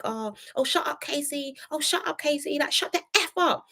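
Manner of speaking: irritated imitation, high and hushed; Harriet. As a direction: mimic her annoyance in a high, soft voice. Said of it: "Harriet started getting very irritated".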